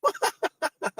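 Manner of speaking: a sudden high-pitched laugh of disbelief; burst of high laughter. Real, not acted. Laughing at the coffee cart opening hours, high burst of laughter.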